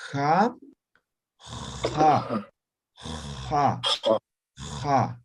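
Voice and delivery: "Ja" rasped hard in the throat; fricative rasped ja. Shown as a demonstration. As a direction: scrape the "Ja" like sandpaper. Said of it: "fricativa, como raspando". ("ja" fricativa, como raspando)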